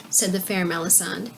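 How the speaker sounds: ordinary delivery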